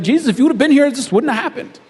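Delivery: hurt, angry reproach at Jesus, half-acted. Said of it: "she's just angry".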